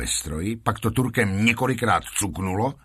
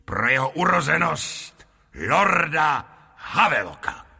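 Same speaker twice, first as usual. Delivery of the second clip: ringmaster's announcement, rising higher by the end; proclaiming; 4 semitones higher than usual. A narrator's reading. Lotrando announces a special show for the lord, proclaiming. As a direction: announce the honoured guest with ceremony. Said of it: "se znova poklonil až k zemi a provolal".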